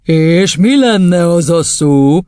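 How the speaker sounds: slow, loud, honeyed question; sugary sweet. Acted. Headmistress baiting the boy, dangerously sweet. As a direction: draw it out with false friendliness. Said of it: "kérdezte nyájasan Doron kisasszony".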